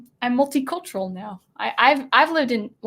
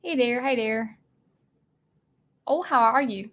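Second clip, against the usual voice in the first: mock Southern accent; 3 semitones higher than usual. Shown as a demonstration. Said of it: "I used to say the O's very well".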